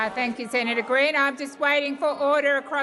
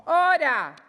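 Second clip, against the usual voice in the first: shouting order; 4 semitones higher, 6 dB louder than usual. Real, not acted. President shouting over noisy chamber.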